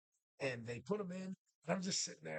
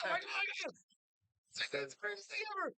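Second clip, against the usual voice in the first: much higher, fast gush of delight; thrilled kid; 12 semitones higher, faster than usual. Retold or imitated. Boy gushing to himself about Power Rangers, much higher and fast. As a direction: squeal it like a kid who just saw the best show ever.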